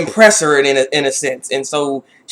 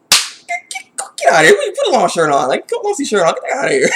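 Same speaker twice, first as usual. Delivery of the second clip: shrill, very high mock mom yelling at first; angry mom; 7 semitones higher than usual. Mom throwing Tomoko out, very high mock yell. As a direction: chase her out of the room. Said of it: "she's like".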